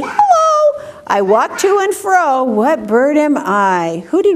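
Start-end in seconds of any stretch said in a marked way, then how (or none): 0.0-0.8 high squawky hello; parrot voice. Pretend. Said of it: "I can say".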